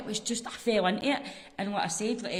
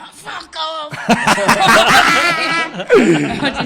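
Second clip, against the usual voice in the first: laughing; 10 semitones higher, 20 dB louder, much slower than usual. Retold or imitated. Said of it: "and I'm like".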